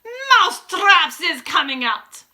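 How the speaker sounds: slow, high, booming witch announcement. A narrator's reading. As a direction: draw it out in a great shout. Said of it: "raised her voice louder than ever and shouted".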